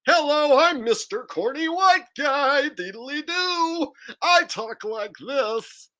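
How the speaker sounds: sing-song mock dork, drawing out the vowels; Dave Chappelle.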